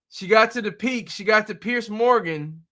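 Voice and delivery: ordinary talk, nothing marked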